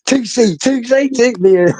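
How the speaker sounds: high, sing-out mock calling; teasing call. [name] trolling Tootsie at a protest, teasing call. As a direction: call the nickname out high to needle someone.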